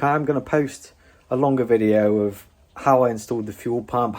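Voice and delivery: usual speaking voice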